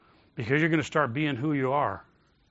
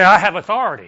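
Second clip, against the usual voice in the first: loud, higher call to repeat; commanding authority; 4 semitones higher, 11 dB louder, faster than usual. Shown as a demonstration. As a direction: speak like a policeman stopping a crowd. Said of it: "speak like a spiritual policeman".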